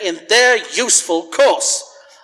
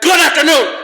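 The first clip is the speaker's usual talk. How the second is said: higher, louder final dismissal; exasperated shout; 9 semitones higher, 5 dB louder than usual. Acted. Scrooge to his nephew, losing patience, shouting goodbye. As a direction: bark him out the door at last. Nephew wishes Happy New Year.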